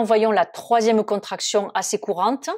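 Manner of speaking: usual speaking voice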